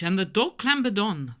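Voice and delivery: ordinary delivery, nothing marked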